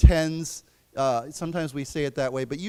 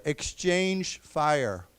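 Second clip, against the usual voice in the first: very slow, each syllable clear; slow repeat-after-me; much slower than usual. Shown as a demonstration. Teacher leading class repetition, slow and clear. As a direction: model the phrase slowly for the class. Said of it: "So let's say that together".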